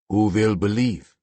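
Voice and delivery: put-on slow, bitter Slavic accent; fist-clenched Slavic accent. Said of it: "Slavic accent".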